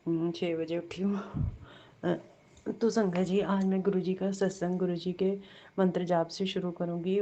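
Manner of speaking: ordinary talk, nothing marked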